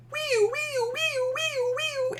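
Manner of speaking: very high siren wee-wee; approaching siren. Shown as a demonstration. Teacher imitating an approaching siren, very high. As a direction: imitate a cop car siren coming toward you, very high. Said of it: "it's like".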